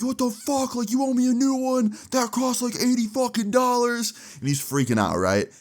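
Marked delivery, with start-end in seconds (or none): 0.0-4.1 high-pitched mock freak-out; very high, hammed-up ranting. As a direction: imitate an outraged student in a strained high voice. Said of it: "Ethan starts freaking out".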